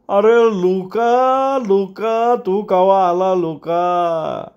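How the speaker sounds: high, long-drawn joyful holler; delighted old man's shout. A narrator's reading. Old father hails the boss on his arrival, delighted. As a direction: stretch the name out in a high old voice. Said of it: "मोठ्यानं हसत म्हातारा ओरडला".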